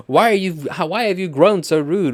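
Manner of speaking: loud, high-pitched acted protest; stage yelling. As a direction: play a hurt lover raising the voice. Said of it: "Everybody is just yelling at this point".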